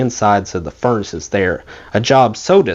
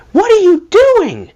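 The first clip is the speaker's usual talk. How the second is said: put-on much higher, louder, slow horrified shriek of an older lady; retold scream; 5 dB louder, slower than usual. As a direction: shriek it in shock at the barefoot child. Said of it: "she screamed at Aunt Ethel".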